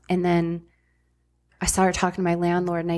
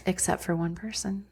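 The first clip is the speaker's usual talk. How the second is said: hushed whisper for the reveal; 6 dB quieter than usual. Real, not acted. Telling friends about her rescuer, in a whisper. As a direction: drop to a whisper to set up the twist.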